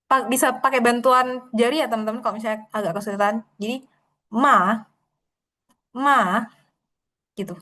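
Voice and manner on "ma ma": MA stretched in a fall and rise; dipping third tone. Shown as a demonstration. Teacher helping with tone three, MA dipped and lifted. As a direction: swoop MA down, then up. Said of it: "nada tiga itu".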